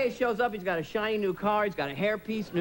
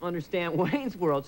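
usual speaking voice

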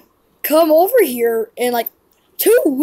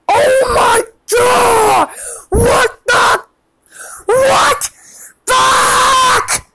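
high, drawn-out wails of alarm; freaked-out hollering; panicked yelling; 4 semitones higher, slower than usual. Acted. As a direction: cry out in horror, stretching the words. Reacts in panic to hook.

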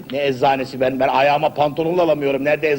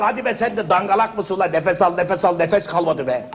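ordinary talk, nothing marked

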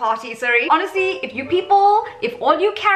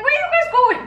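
much higher, louder, puzzled question; surprised call; 4 dB louder than usual. Retold or imitated. On the treadmill asking her friends why they stopped, surprised and much higher.

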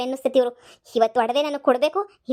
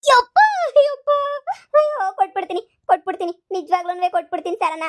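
pained yelp; 5 semitones higher, 5 dB louder than usual. Acted. Hit again, yelps and pleads.

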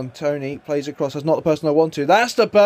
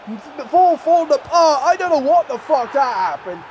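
higher, disbelieving stammer; shocked outburst; 7 semitones higher than usual. Real, not acted. Gamer watching his defence collide, shocked and higher. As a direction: react in disbelief at the collapse.

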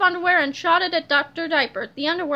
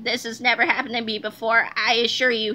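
low-pitched embarrassed doctor; flustered villain voice; 6 semitones lower than usual. A narrator's reading. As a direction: excuse yourself in a lowered character voice. Embarrassed Doctor Diaper makes excuses.

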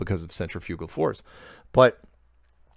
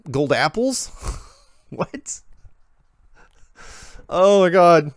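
disbelief; 9 semitones higher, 7 dB louder, slower than usual. Real, not acted. Reacting to absurd email.

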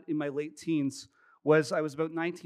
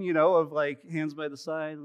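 ordinary delivery

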